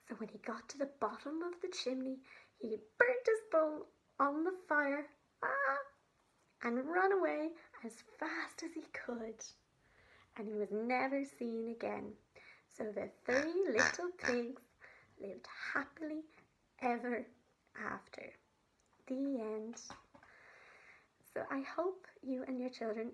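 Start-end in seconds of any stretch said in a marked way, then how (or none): 0.1-5.1 gentle, expressive storytelling for little ones; sensory story read aloud for kids; reading to children. Acted. 5.4-5.8 high pained yelp; a sudden high ouch cry; wolf burning himself on the fire. Acted. 6.6-19.8 gentle, expressive storytelling for little ones; sensory story read aloud for kids; reading to children. Acted.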